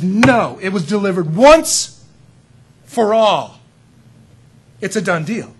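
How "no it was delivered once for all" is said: loud, insistent quote of Jude; emphatic proclamation. Retold or imitated. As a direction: proclaim it firmly, once for all. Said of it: "Jude says".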